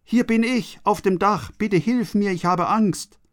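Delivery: high-pitched storyteller's cry for help; frightened child calling. A narrator's reading. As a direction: call down from the roof, scared. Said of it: "Und Benny ruft". (ängstlicher Kinderruf, deutlich höher)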